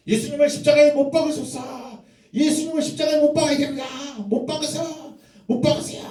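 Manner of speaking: high, loud shouting mob; crowd shouting. Acted. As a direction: yell it like an angry crowd demanding death. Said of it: "유대인들이 뭐라고 얘기하고 있냐면".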